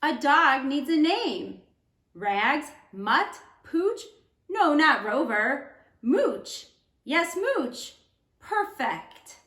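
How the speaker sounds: gentle storybook read-aloud; teacher reading a picture book to students; reading to children. Acted.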